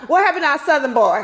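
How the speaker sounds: family asks what became of him, fast and loud; mock family dismay. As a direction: imitate the shocked folks back home. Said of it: "When you go home, it's like".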